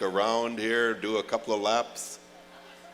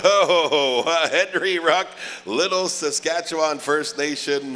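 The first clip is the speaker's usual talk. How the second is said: laughing announcement; 6 semitones higher, 5 dB louder than usual. Real, not acted.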